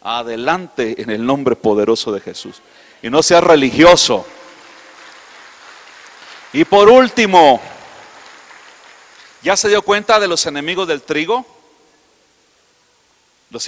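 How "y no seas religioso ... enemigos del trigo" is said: booming pulpit shout, much louder; preaching at full force. Acted. Preacher shouting a warning to his congregation, booming. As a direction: hammer it home to the congregation.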